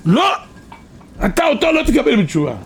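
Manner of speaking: high-pitched protest, acted out; indignant refusal. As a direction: object as an outraged heavenly objector. Acts out angels refusing repentance. (מחאה נרגשת בקול גבוה, בחיקוי)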